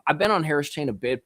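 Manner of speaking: usual speaking voice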